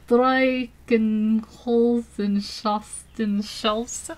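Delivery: "Streichenholzenschaftenschafts-" sounded out very slowly; slow, halting attempt at "Streichenholzenschaftenschafts-", breaking off unfinished; struggling German pronunciation. Real, not acted. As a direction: labor through "Streichenholzenschaftenschafts-" piece by piece. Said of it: "I can't".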